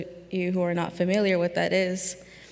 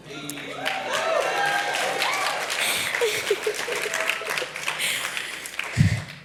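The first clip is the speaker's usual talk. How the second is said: high laugh. Real, not acted. Nervous laugh after listing identities.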